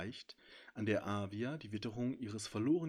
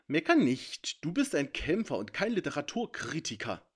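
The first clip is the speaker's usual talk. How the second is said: a louder, higher, biting jab; caustic; 6 semitones higher, 8 dB louder than usual. A narrator's reading. Dragon mocks the centaur's book criticism with a louder, higher, biting jab. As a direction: needle him sharply. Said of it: "ätzte Fang".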